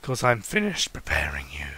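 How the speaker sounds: deep husky whisper; low whisper. Acted.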